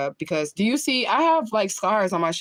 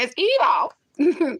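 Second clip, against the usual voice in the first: much higher, louder "evil" through laughter; hammed-up "It's evil", pitched way up; Tracy Barbie's evil; 10 semitones higher, 4 dB louder than usual. Mocking her way of saying evil, high and loud. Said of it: "She say".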